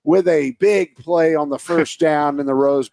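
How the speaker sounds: rising, excited play-by-play; announcer. Pretend. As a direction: hype up the big play like a broadcaster. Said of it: "auditioning to be an announcer".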